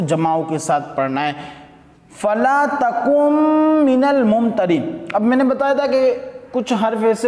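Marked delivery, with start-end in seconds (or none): 2.1-5.1 slow, drawn-out tajweed recitation; slow measured Quranic chant. Shown as a demonstration. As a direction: chant the verse slowly and melodically. Reciting Quran verse in class.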